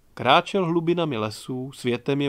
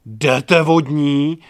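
much louder, very slow howl; 9 dB louder, much slower than usual. A narrator's reading. Inka howling at Čmelda to get away, very loud. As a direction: howl it out in outrage. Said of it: "Inka zavila".

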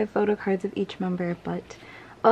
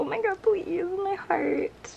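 high swooning squeal; 10 semitones higher, slower than usual. Real, not acted. Squealing over photo card.

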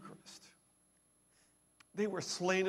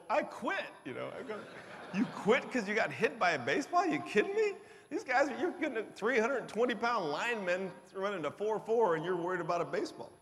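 incredulous; 3 semitones higher, 6 dB louder than usual. Retold or imitated. Mocking a lineman who quit over a baseball, laughing loud disbelief. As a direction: scoff at him like it's absurd.

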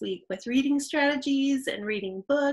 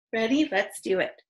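ordinary talk

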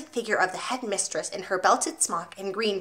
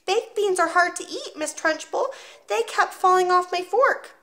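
put-on 1 small boy voice; 10 semitones higher than usual. Boy explains.

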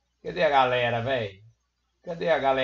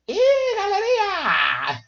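put-on much higher, slow squeaky kid voice; child voice; 5 dB louder, much slower than usual. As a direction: try to sound like a little child. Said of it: "Não tem jeito de eu imitar a voz de criança". (imitando voz de criança)

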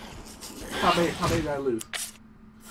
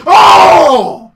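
sudden loud startled yell; startled shout; 6 semitones higher, 19 dB louder than usual. Real, not acted. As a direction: jolt and yell out in shock. Sudden jump scare mid-sentence.

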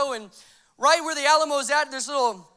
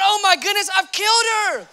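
high, loud alarmed exclamation; mock panic; 6 semitones higher, 8 dB louder than usual. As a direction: cry out in hammed-up horror. Acts out his shocked thought.

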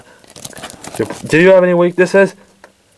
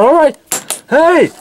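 high, urgent objection; sharp protest; 10 semitones higher, slower than usual. Acted. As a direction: pitch shooting up in alarm. Protest during standoff.

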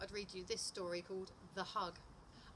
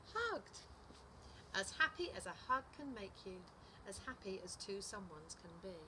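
animated picture-book narration, pitch swinging up; lively storytime voice, key words punched; story reading to children; 5 semitones higher, 4 dB louder, slower than usual. A narrator's reading. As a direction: read it to little children with big expressive emphasis. Reads a picture book aloud.

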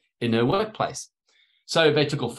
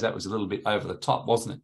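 lower, quicker sheepish aside; understated, slightly embarrassed confession; retold sheepish admission; 5 semitones lower, 5 dB quieter, faster than usual. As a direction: play him quietly owning up to his wife. Retold sheepish admission to his wife.

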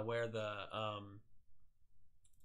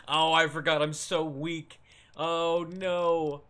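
loud, higher cry of dismay; dismayed outcry; 4 semitones higher, 9 dB louder, slower than usual. Real, not acted. Gamer facing too many enemies while weak, loud dismay. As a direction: cry out as the trouble dawns on you.

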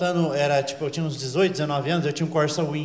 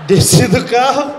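louder, higher, drawn out; loud slow exclamation; 4 semitones higher, 8 dB louder, slower than usual. Retold or imitated. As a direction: blurt it out big. Descendo do carro, assustado. (alto e arrastado)

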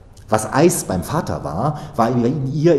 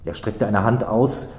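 usual speaking voice

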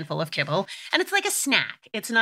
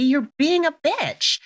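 self-scolding; 9 semitones higher, 4 dB louder, slower than usual. Retold or imitated. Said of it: "I had this moment where I was like".

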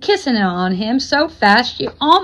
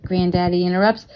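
ordinary delivery, nothing marked

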